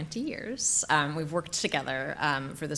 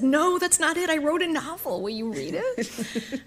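high, nervous blurt retold; nervous confession; 11 semitones higher than usual. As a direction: imitate a friend nervously owning up, pitched well up. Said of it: "she laughed really nervously and said".

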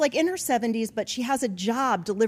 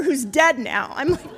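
ordinary talk, nothing marked

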